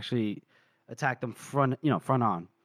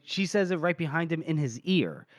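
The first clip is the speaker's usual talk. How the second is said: usual speaking voice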